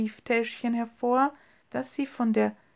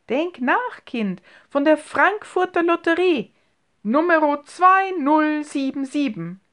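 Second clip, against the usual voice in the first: higher, louder, urging; insistent; 6 semitones higher, 5 dB louder than usual. A narrator's reading. Uncle urges Emilie to recall the lottery ticket, higher and louder. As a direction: press her eagerly to remember.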